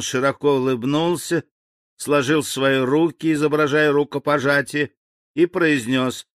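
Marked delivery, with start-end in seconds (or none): none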